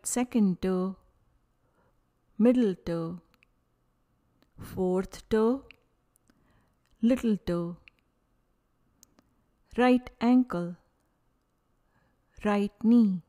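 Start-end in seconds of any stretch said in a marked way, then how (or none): none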